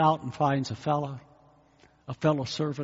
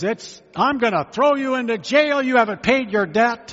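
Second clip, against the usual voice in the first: loud, higher threat to throw him in jail; stern threat; 8 semitones higher, 9 dB louder than usual. Acted. Rich master threatens his debtor, loud and stern. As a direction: threaten the servant loudly.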